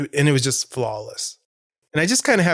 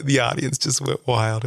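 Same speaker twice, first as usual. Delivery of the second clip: ordinary delivery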